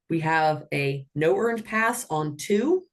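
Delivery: ordinary talk, nothing marked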